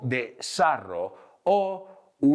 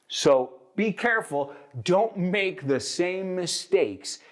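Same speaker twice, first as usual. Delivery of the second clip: ordinary talk, nothing marked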